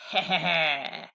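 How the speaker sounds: short gloating cackle; villain chuckle; mocking laugh. A narrator's reading. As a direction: laugh at the miss. Tooth laughs.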